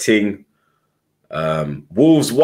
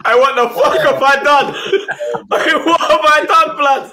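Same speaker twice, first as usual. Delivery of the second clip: high-pitched laughing protest; 14 semitones higher, 3 dB louder than usual. Real, not acted. Friend laughing helplessly at his mates' teasing. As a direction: squeal it through laughter, higher. Cracking up after the slip.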